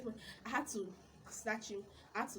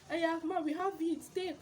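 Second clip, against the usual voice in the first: high, loud eager reply; louder high eager seller voice; 8 semitones higher, 5 dB louder than usual. Retold or imitated. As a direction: sound keen to make the sale. Retold supplier reply, raised.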